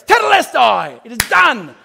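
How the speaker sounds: high, loud and gleeful; gleeful loud declaration; triumphant farewell. Pretend. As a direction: crow it at your parents.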